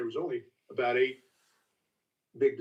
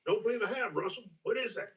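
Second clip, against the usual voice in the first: put-on old Booker; 9 semitones higher than usual. Booker answering Jimmy, innocent high country voice. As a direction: play the unsuspecting old distiller. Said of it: "Booker goes".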